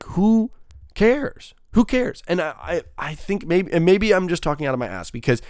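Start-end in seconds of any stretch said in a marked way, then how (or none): 0.0-2.2 much higher, pushed out, who cares; exasperated. Real, not acted. Ranting at endless Captain America sequels, exasperated higher cry. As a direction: throw up your hands, who cares. Exasperated rant about superhero titles.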